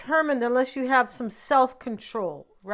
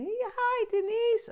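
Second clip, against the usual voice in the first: much higher, squeaky mock boyfriend greeting; mocking ex-boyfriend's voice; 12 semitones higher, 3 dB quieter than usual. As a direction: mimic the ex's voice she couldn't stand, mocking. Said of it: "if I don't like his voice, I'm out of there".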